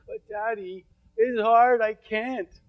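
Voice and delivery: a hint of a small girl pleading; little daughter's voice.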